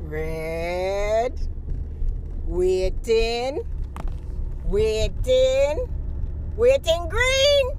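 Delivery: loud, high patois character voice, building up; hammed-up Jamaican auntie signing off. Jamaican character signing off to viewers, loud and building. Same character at traffic light.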